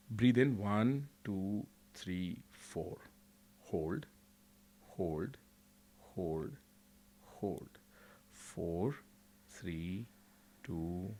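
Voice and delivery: soft, fading counts, near whisper; quiet meditation guide; guided breathing count. Acted. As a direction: count slowly and calmly. Guided breathing and happy memory.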